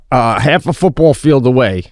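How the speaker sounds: ordinary delivery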